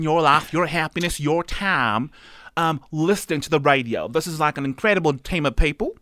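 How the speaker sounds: exaggerated American TV counsellor; drawling American advice-giver impression; Doctor Phil. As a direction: hold forth like a talk-show therapist. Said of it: "I think we need Doctor Phil".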